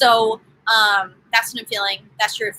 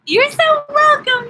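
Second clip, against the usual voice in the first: high, slow, louder sing-song welcome; gushing, drawn-out you're so welcome; sing-song thanks; 6 dB louder, slower than usual. Real, not acted. Thanking a viewer for a tip.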